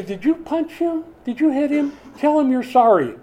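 high, loud mock dad interrogation; scolding parent. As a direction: haul a guilty child up for hitting. Acting himself scolding his sons.

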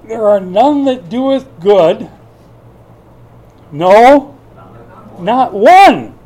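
slow quote, rising to a loud cry; preacher builds scripture to a shout; building scripture shout. Real, not acted. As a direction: proclaim it slowly, then cry out loudly.

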